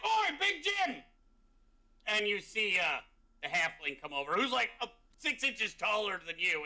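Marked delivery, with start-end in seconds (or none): 0.0-1.0 high-pitched character call; pitched-up "oi" across a distance; hailing someone. Acted. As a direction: call out to a mate. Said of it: "he's like".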